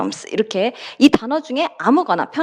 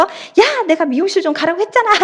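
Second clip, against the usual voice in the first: sudden high, fast irritated outburst; losing her temper; 7 semitones higher than usual. Retold or imitated. Dambi losing her temper at her friend, high and fast. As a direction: fire it off like a fed-up friend. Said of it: "성질 내면서".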